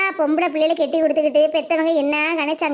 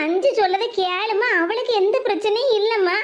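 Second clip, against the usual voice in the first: ordinary delivery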